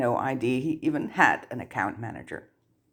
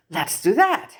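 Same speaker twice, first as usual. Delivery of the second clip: quick, high, louder eager jump-in; 9 semitones higher, 5 dB louder than usual. A narrator's reading. Harry eager to the goblin, high keen reply. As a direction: grab at the idea at once.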